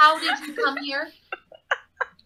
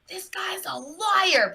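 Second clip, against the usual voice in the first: high, drawn-out accusation; slow, higher-pitched aside; aside to companions; 7 semitones higher, much slower than usual. Acted. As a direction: stretch it out slowly, pitched up. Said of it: "I lean back and I'm like".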